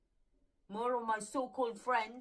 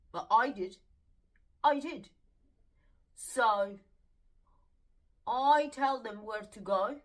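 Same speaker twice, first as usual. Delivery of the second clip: defiant; 8 dB louder than usual. Real, not acted. Defiant boast about telling them off.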